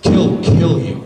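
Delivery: threatening. Retold or imitated. Neighbor threatening the speaker's life, loud and slow. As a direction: spit the threat out slowly and loudly. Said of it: "threatens me with my life".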